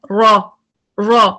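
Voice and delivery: रॉ said slow, thick and heavy. Shown as a demonstration. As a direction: draw out a heavy रॉ. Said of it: "heavy है".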